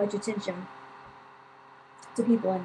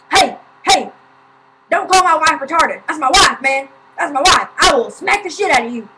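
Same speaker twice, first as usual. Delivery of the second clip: loud, high, angry imitation of a husband; retold yelling; 6 semitones higher, 10 dB louder than usual. Husband confronts man who insulted his wife, imitated yelling. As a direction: yell it as a mimicked threat, defending your wife. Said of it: "were just, like, yelling".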